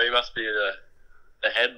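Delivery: ordinary talk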